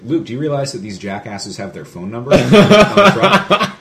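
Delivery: laughing through the line, rising high at the end; cracking up while telling Luke; laughing quote. Retold or imitated. Friend laughing to Luke about the jerks, climbing into laughter. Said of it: "And I said".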